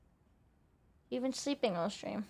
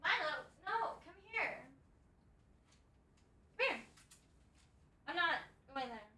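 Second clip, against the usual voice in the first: coaxing cat call; 4 semitones higher, 6 dB quieter, faster than usual. Real, not acted. Calling cat back softly.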